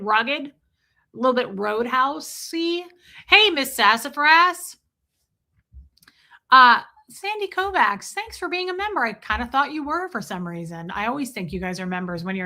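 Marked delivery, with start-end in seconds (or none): 3.3-4.8 bright, louder hello to a viewer; cheery greeting. Real, not acted. 6.5-9.0 high, bright thank-you to a viewer; high cheery thanks. Real, not acted.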